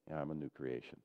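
half-hearted mumble. Shown as a demonstration. Pastor mocking a congregation saying it without belief, mumbled. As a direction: toss it off like you don't mean it. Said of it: "that's different than".

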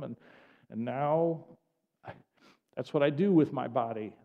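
ordinary talk

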